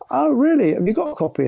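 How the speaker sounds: imitating Steve's high, bright surprise; surprised. Steve spots the poster.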